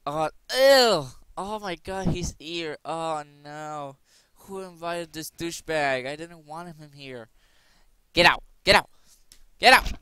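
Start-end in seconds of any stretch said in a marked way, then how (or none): 0.1-1.1 disgusted ew. Pretend. Disgusted reaction acted. 8.1-9.9 loud high yelling; mock furious shout, much louder; shouting get out. Acted crowd ejecting.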